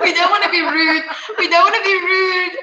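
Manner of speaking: high, loud, through laughter; laughing protest. Real, not acted. Guest backing off a cheeky comeback, laughing loudly. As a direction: call it out over the laughs, repeating it.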